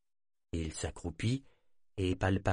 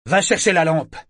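sharp, loud, higher bark; harsh barking commands; 7 semitones higher, 10 dB louder than usual. Acted. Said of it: "ordonna sèchement l'esclave à Yémin". (ordre sec et crié)

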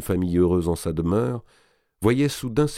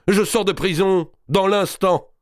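exclaiming, much higher and louder; exclaimed; 12 semitones higher, 5 dB louder than usual. Acted. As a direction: cry it out triumphantly. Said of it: "s'écria-t-il". (s'écrie, bien plus haut et plus fort)

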